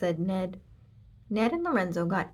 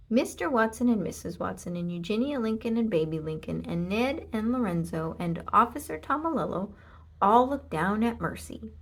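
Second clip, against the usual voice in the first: ordinary delivery, nothing marked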